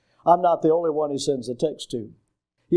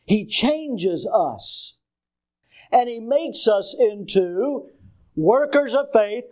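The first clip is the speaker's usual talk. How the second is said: slow, higher-pitched pulpit delivery with stretched key words; emphatic preaching; 7 semitones higher, slower than usual. Real, not acted. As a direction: hammer each phrase home to the congregation. Preaching with force.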